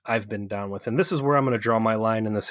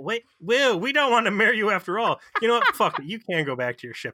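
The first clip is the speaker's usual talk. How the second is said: higher, faster voice of the spurned wives; mock offended women; 8 semitones higher than usual. Host voicing the women telling Harry to leave, mock offended higher voice. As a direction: play the jilted wives brushing him off.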